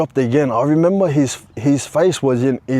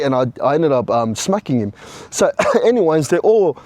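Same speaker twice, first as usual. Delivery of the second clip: ordinary delivery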